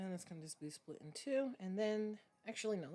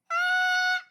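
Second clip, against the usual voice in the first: villain scream; 18 dB louder than usual. Pretend. Ends villain bit with cry.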